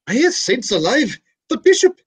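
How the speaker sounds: very high and slow, climbing to a squeak on "the bishop"; a hammed-up Irish gasp of alarm; startled Irish priest. Irish priest spots the bishop in the pew, mock shock. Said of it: "there's an old Irish priest".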